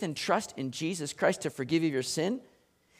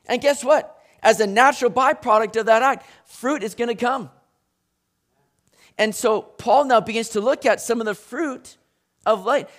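usual speaking voice

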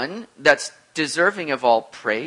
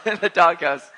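laughing repeat; 5 dB louder than usual. Real, not acted.

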